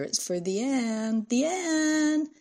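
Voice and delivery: drawn-out call climbing high; boy hollering for someone in another room; slow, rising sing-out shout; shouting across house. A narrator's reading. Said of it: "shouted loud enough for the Ann".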